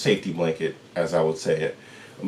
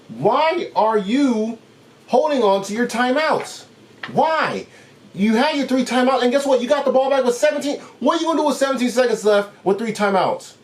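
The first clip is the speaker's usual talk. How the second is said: pleading in disbelief at the sideline; exasperated rant; 14 semitones higher than usual. Real, not acted. Vlogger ranting at the Broncos coach, high exasperated disbelief.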